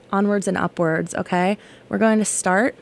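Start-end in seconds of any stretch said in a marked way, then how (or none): none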